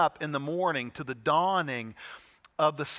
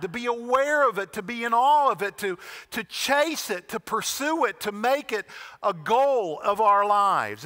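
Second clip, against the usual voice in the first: higher, louder, building exhortation; impassioned preaching; 5 semitones higher, 5 dB louder than usual. Acted. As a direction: lift it into a rallying cry.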